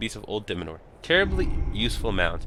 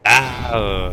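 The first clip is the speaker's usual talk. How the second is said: pained yelp; 3 semitones higher, 8 dB louder than usual. Acted. Falling onto the ground.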